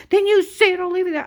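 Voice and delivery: high, loud, fast mock threat; hammed-up furious agent ultimatum; imitating angry agent. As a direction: bark the ultimatum like a bully agent. Agent threatening in retold quarrel.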